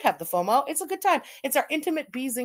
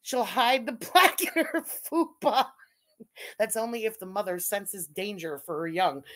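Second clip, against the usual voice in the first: ordinary talk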